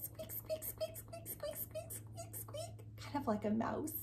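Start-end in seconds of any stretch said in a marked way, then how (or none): 0.0-2.7 tiny high unvoiced squeaks; soft high squeaking; groundhog squeaks. Shown as a demonstration. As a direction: squeak like a little rodent. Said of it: "Can you squeak like a groundhog".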